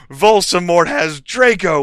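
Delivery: high, loud alarm; shouted. A narrator's reading. Students telling Dumbledore about Draco, loud high shout. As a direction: shout the news urgently. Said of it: "we shouted at the same time".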